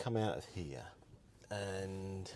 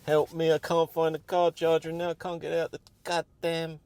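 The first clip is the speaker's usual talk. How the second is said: higher, louder mock plea, fed up; exasperated cry for help; 8 semitones higher, 7 dB louder than usual. Real, not acted. Driver who can't find a charger pleads loudly to viewers. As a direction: wail it out at the camera, half joking. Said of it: "this annoyed on camera".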